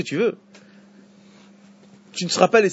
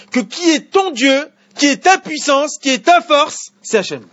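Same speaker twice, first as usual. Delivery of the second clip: much higher and louder, driving every phrase home; full-force preaching; 9 semitones higher, 7 dB louder than usual. Acted. Rabbi preaching Sinai to his class, high and loud. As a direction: preach it to the whole room with fire.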